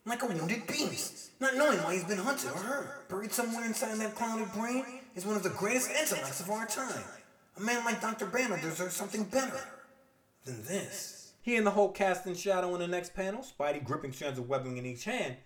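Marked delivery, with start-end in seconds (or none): 0.1-11.1 sympathetic, pained reflection; Spider-Man voice. Acted. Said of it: "having a crisis of conscience".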